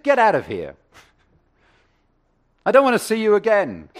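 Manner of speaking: loud, high hammed-up telling-off; mock angry. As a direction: shoo him out in mock fury. [name] chases the customer away.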